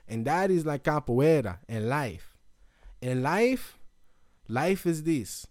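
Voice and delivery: mock-sage Brazilian accent. Master likening jiu-jitsu to life, put-on Brazilian accent. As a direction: philosophize like a martial arts master with a Brazilian accent. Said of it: "Brazilian accent all morning".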